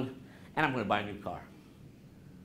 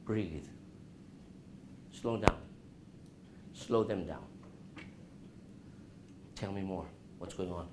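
calm, soft and slow; 7 semitones lower, 11 dB quieter than usual. Shown as a demonstration. Teacher calming a stressed friend, soft and low. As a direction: lower and softer to calm a panicked friend. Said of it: "What did I just do about my voice?".

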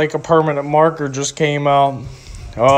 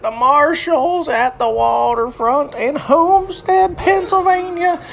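high, slow announcer voice; show host intro; 9 semitones higher, slower than usual. Acted. Vlogger opening a bathroom review, high announcer lilt. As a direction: sing out the welcome like a TV host.

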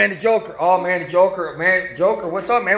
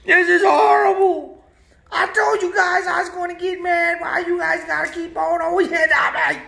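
high, crying complaint, much higher; whiny hurt referee; 9 semitones higher than usual. Acted. Referee whining at the racers who keep crashing into him. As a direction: wail like a toy referee sick of being hit.

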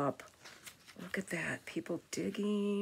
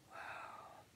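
very soft, breath-only wow; hushed little marvel; whispered awe; 20 dB quieter than usual. Real, not acted. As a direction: whisper it in wonder. Hushed wonder at the picture.

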